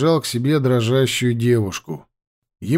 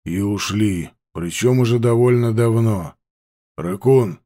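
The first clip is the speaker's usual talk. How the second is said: gruff man voice; 3 semitones lower than usual. Acted.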